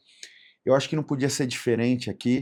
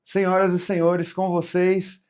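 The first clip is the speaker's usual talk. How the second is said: grand entrance announcement; 5 semitones higher, 4 dB louder, slower than usual. Acted. Host introduces guests on podcast.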